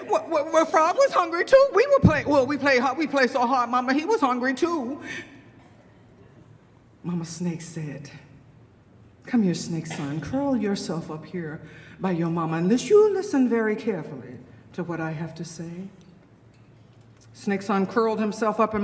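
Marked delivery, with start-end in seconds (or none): 0.1-5.0 put-on high, insistent child voice; protesting child snake. Snake Son explains himself to Mama, eagerly protesting. 9.2-15.7 low, coaxing motherly summons; grave mother snake. Acted. Mama Snake calls her son to listen, low and grave.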